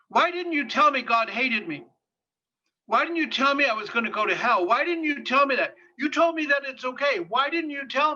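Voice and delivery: put-on a hurt young man demanding answers; the client's anguished accusation; distraught pleading. Distressed client confronting therapist.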